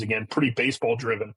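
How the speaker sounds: usual speaking voice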